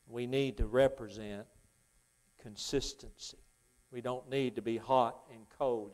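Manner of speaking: usual speaking voice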